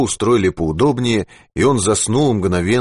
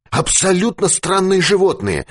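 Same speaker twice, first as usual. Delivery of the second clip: high, feverish babble; delirious high-pitched talk; confused rambling; 12 semitones higher than usual. A narrator's reading. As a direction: blurt it out, muddled and agitated. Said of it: "путанно заговорил Дауги".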